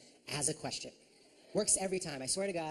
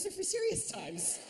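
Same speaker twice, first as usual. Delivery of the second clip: normal talking voice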